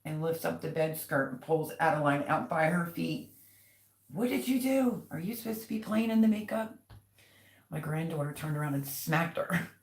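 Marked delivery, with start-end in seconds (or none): 4.1-6.8 high-pitched mock scolding of a child; retold scolding. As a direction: imitate an exasperated mother, pitched well up. Retold mother scolding child over makeup.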